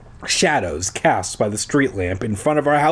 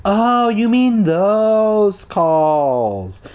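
slow, much higher, sing-song innocent act; feigned innocence; 8 semitones higher, 4 dB louder, much slower than usual. A narrator's reading. Ricky to Dad, faking innocence, slow and sing-song. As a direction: drag it out, playing dumb. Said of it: "I tried to sound innocent".

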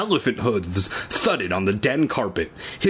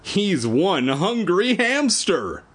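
hammed-up tagline; 7 semitones higher, slower than usual. Shown as a demonstration. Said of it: "And the tagline is".

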